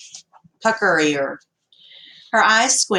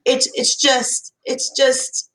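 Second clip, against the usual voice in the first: faltering; 6 semitones higher, slower than usual. A narrator's reading. Girl faking distress.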